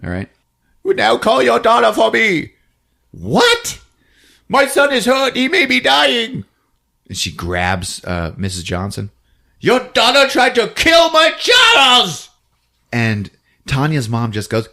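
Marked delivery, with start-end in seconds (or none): 0.8-6.4 high, pleading demand; mother confronting the other mother, high and urgent; hammed-up mother. Two mothers confront each other. 9.6-12.3 high, loud, shouted; mother shouting an accusation at the other mother; furious accusation. Acted. Mothers fighting.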